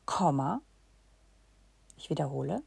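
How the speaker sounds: usual speaking voice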